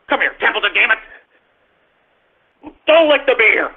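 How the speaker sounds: ordinary talk, nothing marked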